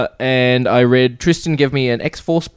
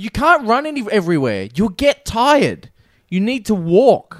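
higher, slow nagging voice; pedantic roleplayer; 9 semitones higher, slower than usual. Retold or imitated. Roleplayer nagging him to walk, slow smug voice. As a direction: spell out the rules slowly and smugly. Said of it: "he's like".

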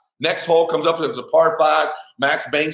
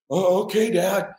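put-on hesitant kid; 9 semitones higher than usual. Voices his hesitant young son.

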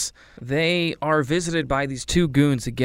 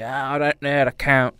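put-on Cockney accent; 3 semitones higher, 3 dB louder than usual.

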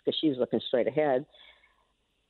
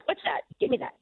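higher, quick retold demand; mock demanding; 11 semitones higher than usual. Grandmother voices gunman spotting her daughter's phone, mock demanding. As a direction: lightly imitate a robber grabbing something.

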